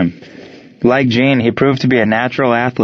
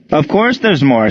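snapped retort; 6 semitones higher than usual. A narrator's reading. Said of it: "shot out Ray".

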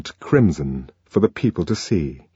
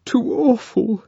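high, upset Lenina voice; high distressed murmur; tearful; slower than usual. A narrator's reading. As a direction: shaken and close to tears. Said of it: "Lenina was still sobbing".

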